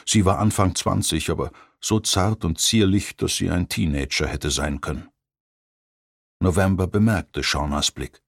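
usual speaking voice

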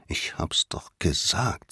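slow, soft urgent whisper; breathless whispered warning; hissed whisper. A narrator's reading. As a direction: hiss it to your brother so no one hears. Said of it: "zischte er seinem Bruder zu".

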